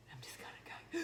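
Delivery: put-on whispered, sulky teen leaving; whispered exit. Teen daughter sulkily leaving for work, whispered. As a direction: breathe it out under your breath.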